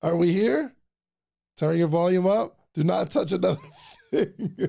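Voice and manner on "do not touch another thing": laughing as he says it; a real laugh breaking the line. Real, not acted. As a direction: laugh through the warning to yourself. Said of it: "Yeah, he said".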